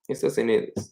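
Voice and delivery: ordinary delivery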